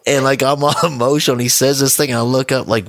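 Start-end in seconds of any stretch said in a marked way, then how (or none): none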